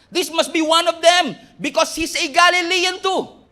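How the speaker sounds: loud, slow accusing call. Acted. Servant accusing Peter, loud slow insistence. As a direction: point him out to the crowd.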